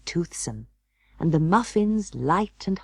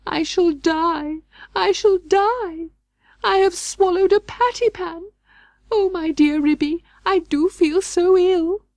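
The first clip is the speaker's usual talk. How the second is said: howling wail; 14 semitones higher, 5 dB louder than usual. Acted. Duchess howling to Ribby that she swallowed a patty pan. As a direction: wail it like a dying cat. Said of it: "Duchess set up a howl".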